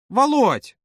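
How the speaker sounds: high-pitched eager call; exclaiming. A narrator's reading. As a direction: call out his name on a high note. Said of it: "воскликнула Маша". (восклицание высоким голосом)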